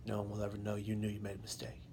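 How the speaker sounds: fast breathy whisper to himself. Retold or imitated. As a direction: hush it out, urgent and quick. Inner plea while drowning.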